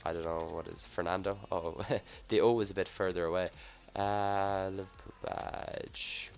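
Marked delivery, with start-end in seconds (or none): none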